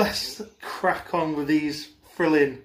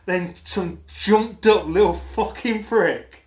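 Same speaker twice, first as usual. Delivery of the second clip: angry; 4 semitones higher, slower than usual. Real, not acted. Ranting about rude cinemagoer.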